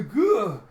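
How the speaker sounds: high, loud "gah" yelp; loud monster cry. Retold or imitated. Narrator voicing the ogre's attack, loud cry. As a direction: blurt a loud cry as the ogre. Ogre bellows in the retold fight.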